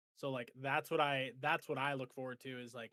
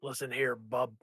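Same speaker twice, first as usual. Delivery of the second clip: a lightly voiced Wolverine, barely deeper; 6 dB louder than usual. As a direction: growl it out like a half-hearted Wolverine. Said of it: "say bub in a really deep Wolverine type voice".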